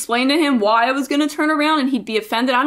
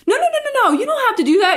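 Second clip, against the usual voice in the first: much higher hurried mock refusal; flustered protest; 12 semitones higher, much faster than usual. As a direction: wave off the free pizza in a fluster. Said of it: "And I'm like".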